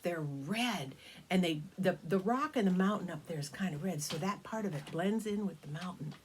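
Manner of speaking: usual speaking voice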